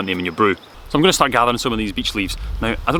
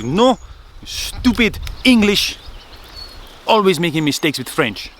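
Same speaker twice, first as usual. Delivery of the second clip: put-on high-pitched, irate French accent; hot-blooded [name], French accent; 9 semitones higher, slower than usual. French friend berating the English for mistakes, in a hot-blooded French accent. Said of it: "You're really hot-blooded French sometimes".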